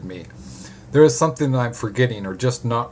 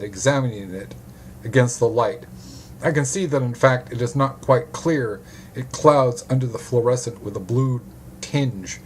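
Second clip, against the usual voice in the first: ordinary delivery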